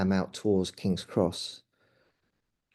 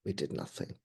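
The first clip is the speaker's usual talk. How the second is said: much softer and lower, sinking; quiet despair; 6 semitones lower, 11 dB quieter than usual. Real, not acted. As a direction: let the words fall away to almost nothing. Repeating the failure, voice dropping.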